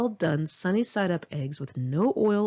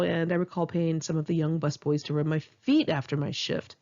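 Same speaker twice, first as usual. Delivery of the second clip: ordinary talk, nothing marked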